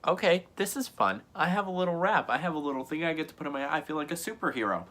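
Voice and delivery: high, quickening chirpy quote; childlike delight. Retold or imitated. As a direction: imitate a kid pleased with a new toy. Kid delighted with his bandage.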